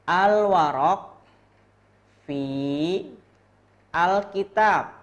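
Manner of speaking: clear model reading. Shown as a demonstration. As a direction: model Al-warok fi al-kitab loudly for the class. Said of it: "ini cara bacanya gimana nih".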